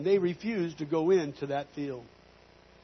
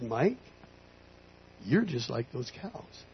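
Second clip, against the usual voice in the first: low, soft, slow divine voice; voice of God; 4 semitones lower, 6 dB quieter, slower than usual. Retold or imitated. God quietly correcting the rancher in the field, low God voice. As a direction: speak softly and slowly as God. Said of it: "the voice of God".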